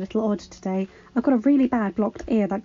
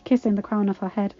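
ordinary delivery

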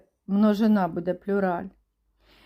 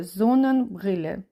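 ordinary delivery

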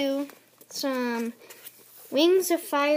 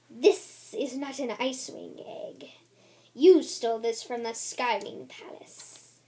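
voiceless, secretive dragon whisper; 3 semitones lower than usual. Acted. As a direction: hiss the accusation as a breathy whisper. Dragon character's quoted accusation.

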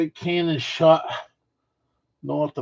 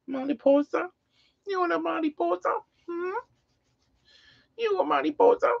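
very high cooing questions; 5 dB quieter than usual. Real, not acted. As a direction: coo up high at the pet. High baby talk to pet.

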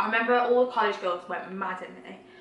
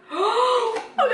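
surprised gasp; 11 semitones higher, 4 dB louder than usual. Real, not acted. Remembering a good story.

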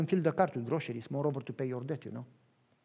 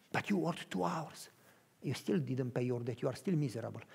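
quiet, confiding aside; low hushed preacher voice; 6 semitones lower, 8 dB quieter than usual. Acted. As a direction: drop to a near whisper.

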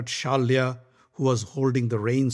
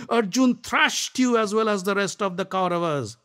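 higher, mocking jeer; scoffing Shalya; 8 semitones higher, 4 dB louder than usual. Shalya mocking Karna's record, scoffing. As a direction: belittle him with glee.

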